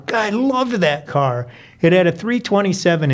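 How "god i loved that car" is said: higher-pitched, heartfelt exclamation; fond enthusiasm. Acted. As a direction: gush over a beloved old car. Narrator fondly recalls first car.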